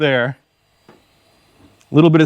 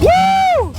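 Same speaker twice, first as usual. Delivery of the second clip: high joyful woo; excited whoop. Real, not acted. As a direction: whoop of triumph. Engine running, celebrating.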